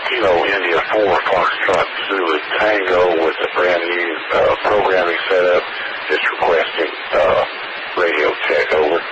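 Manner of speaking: distant voice over a radio link; radio transmission sound; crackly military radio. Real, not acted. Radio check over ham net.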